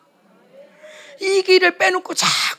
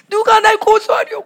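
high, loud tearful cry; imitated weeping shout of defiance; crying proclamation; 8 semitones higher, 7 dB louder than usual. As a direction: declare it through acted sobs. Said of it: "막 울면서 막 선포하는 거야".

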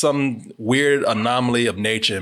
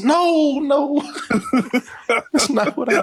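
much higher flustered no; genie; 12 semitones higher than usual. Pretend. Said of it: "did Corey find a genie".

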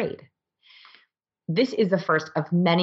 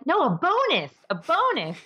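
much higher, pleading emphasis on bonus; mock insistent excitement; 12 semitones higher than usual. Boss pressing her employee to be thrilled about the bonus, high and insistent. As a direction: push the word bonus, begging for a reaction.

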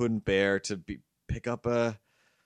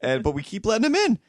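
exasperated; 9 semitones higher, 6 dB louder, faster than usual. Real, not acted. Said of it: "frustrated about the cat".

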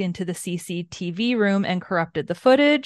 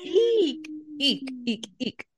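squealing eek; 9 semitones higher, 4 dB louder, slower than usual. Real, not acted. Repeated shocked squeals.

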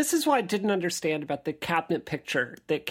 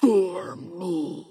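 wraith voice; 2 semitones lower than usual. Shown as a demonstration. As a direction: threaten like a wraith. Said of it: "will you do it one more time".

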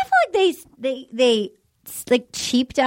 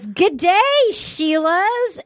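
mock Australian greeting, pitched up; bright imitation of a fake Aussie accent; 6 semitones higher, much slower than usual. Mimicking the Bachelor's Australian greeting to the women, mock Australian accent. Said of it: "he starts talking in an accent".

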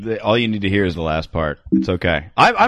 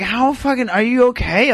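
put-on higher, girly quoted concern; mock girl voice; 13 semitones higher than usual. Man at a bar quoting a girl, high mock girly concern. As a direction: pitch up to play the girl at the bar. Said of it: "she's like".

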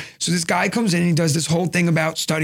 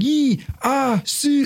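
counting in Chinese; 6 semitones higher, much slower than usual. Retold or imitated. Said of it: "I was like".

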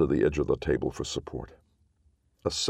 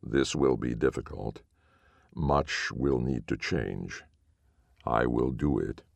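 strange echoing voice; 5 semitones lower than usual. A narrator's reading. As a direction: speak like a hollow echoing clay golem. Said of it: "in its strange echoing voice".

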